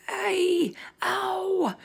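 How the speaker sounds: high, drawn-out yelps; sleeping giant yowling; pained roar. Acted. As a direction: wail each cry of pain long and high. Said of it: "roared the flesh lump eater".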